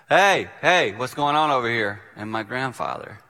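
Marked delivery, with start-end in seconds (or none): 0.1-2.0 loud holler from out of the woods; calling from afar. Retold or imitated. Grandfather calling out to the family, loud holler. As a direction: holler to break up a fight. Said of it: "there was this voice going".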